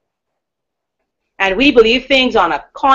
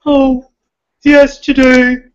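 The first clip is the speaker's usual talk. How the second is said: higher, slow, affected little voice; put-on unreal voice; 4 semitones higher, 4 dB louder, slower than usual. Shown as a demonstration. Showing the voice she rejects, high slow put-on tone. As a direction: draw it out in a false, powerless voice. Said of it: "Not the voice that says".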